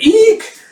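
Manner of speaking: loud, very high eek; short piercing shriek; startled squeal. Acted. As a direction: squeal in cartoon fright. Erica throws whirling cane.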